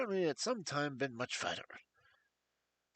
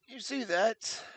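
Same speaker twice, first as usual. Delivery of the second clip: higher, anxious voice of a frightened man; nervous fat patient; 7 semitones higher, 5 dB louder, slower than usual. Acted. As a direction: plead with jangled nerves. Blessington points at the box.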